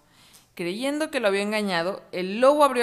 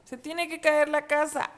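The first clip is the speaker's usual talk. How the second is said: whiny wolf protest; 8 semitones higher than usual. Acted.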